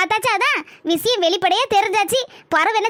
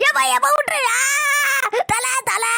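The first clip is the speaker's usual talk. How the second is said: high crying yelp to let go; pained yelp; 4 semitones higher, much slower than usual. Acted. Man yelps at his wife to let go of his head. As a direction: cry out as he is grabbed. Yelping under a head grab.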